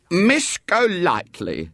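a drawn-out, high stern call; slowly, like a scolding mother. A narrator's reading. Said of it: "she said sternly".

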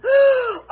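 sharp high gasp of horror; shocked gasp. Acted. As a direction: gasp as if scandalized. Mother hears daughter married.

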